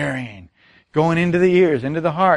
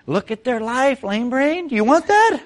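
much higher, teasing jab; playful higher-pitched ribbing; mock scolding; 7 semitones higher than usual. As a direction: tease the listener playfully. Preacher mocks a sceptic.

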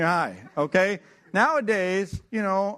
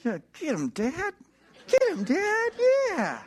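put-on rising ever higher, a kid egging his dad on; gleeful cheering; 12 semitones higher, slower than usual. Son silently cheering his dad against the teacher, excited. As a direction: cheer him on like a ringside fan.

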